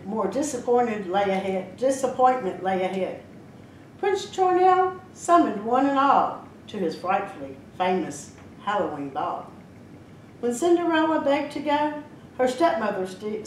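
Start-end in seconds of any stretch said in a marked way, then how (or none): none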